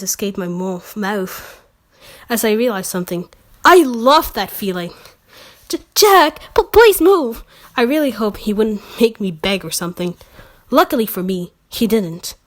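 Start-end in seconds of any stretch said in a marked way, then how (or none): 5.7-7.4 breathless plea. Acted. Pleading to Jack.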